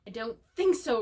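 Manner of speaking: loud roar of Moody. A narrator's reading. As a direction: roar it at the ferret. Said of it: "roared Moody".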